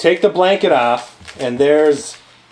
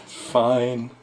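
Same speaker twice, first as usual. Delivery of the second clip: low, soft mock resignation; grudging surrender; 6 semitones lower, 9 dB quieter than usual. Retelling a partner giving in to the idea, resigned. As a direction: give in grudgingly, low and quiet.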